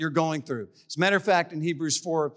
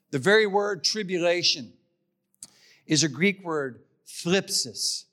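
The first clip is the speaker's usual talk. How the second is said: normal talking voice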